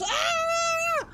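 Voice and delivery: very high, hammed-up scream; imitated scream of terror. Streamer imitating Johnny screaming in the spider jungle, shrill scream. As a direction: let out a panicked shriek. John screaming behind them.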